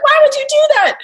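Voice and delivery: high, loud startled snap, retold; half-real angry yelp at a son who snuck up; retold angry outburst. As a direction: fling it back high and loud as if just scared. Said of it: "like, angry".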